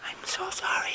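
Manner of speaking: high breathy whispered apology; mock whisper. Vlogger joking to camera, high mock whisper.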